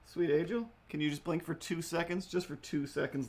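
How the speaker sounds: high, sweet pleading to the cat; sweet coaxing. Real, not acted. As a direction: coax the cat to blink.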